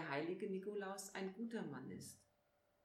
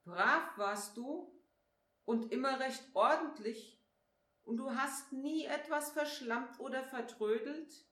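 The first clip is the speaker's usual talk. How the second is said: higher, louder, mock-disbelieving questions; sceptical questioning; 5 semitones higher, 5 dB louder than usual. A narrator's reading. Nikolaus challenges Flori's claim of being good, sceptical.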